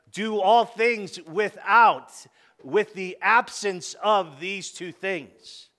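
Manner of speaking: slow and loud, stressing all; emphatic scripture reading. Acted. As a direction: hammer the verse home. Preacher stresses verse slowly.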